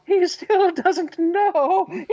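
high, slow, giggling emperor voice. Acted. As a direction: draw it out through giggles. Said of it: "giggling and going".

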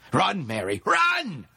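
shouted warning leaping to a high scream; frantic cry to flee; desperate scream. A narrator's reading. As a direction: build from urgent shout to shriek. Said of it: "he screamed as his body was jerked".

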